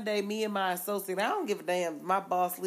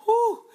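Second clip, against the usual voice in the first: relieved whew; 14 semitones higher, 9 dB louder than usual. Retold or imitated. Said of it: "I said".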